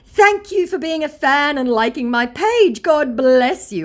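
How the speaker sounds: high, loud, syrupy put-on sweetness; mock scammer voice. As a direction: ham up a fake celebrity gushing. Reading the scammer's message aloud.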